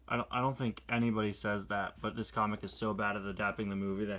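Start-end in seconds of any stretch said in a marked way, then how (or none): none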